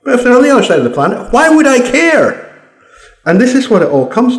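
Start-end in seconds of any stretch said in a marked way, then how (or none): 1.3-2.6 high, drawn-out indignant question; exasperated rant. Real, not acted. Speaker dismissing offended people far away, exasperated. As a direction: throw it out high and slow, exasperated.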